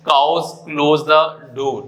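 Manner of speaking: each word read out slowly for the board; slow dictation. Shown as a demonstration. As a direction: spell it out slowly, no pause after Cows. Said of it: "एक sentence मैंने top पे लिखा है". (धीरे-धीरे board से पढ़ना)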